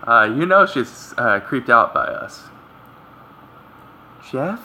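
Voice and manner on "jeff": higher, rising surprised question; surprised girl. Acted. Girl sees her childhood friend, surprised questioning voice. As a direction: say the name in startled, high-pitched surprise. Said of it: "The girl, surprised, utters my name".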